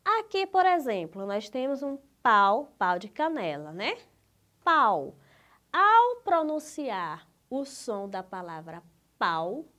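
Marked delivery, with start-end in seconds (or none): 4.6-5.2 "Pau" said loud as a single beat; one unbroken syllable. Shown as a demonstration. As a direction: say "Pau" in one go, without any pause. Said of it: "você não faz nenhuma pausa".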